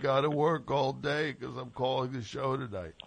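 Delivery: hint of a Southern accent; slight Southern accent. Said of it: "I'm doing my slight Southern accent".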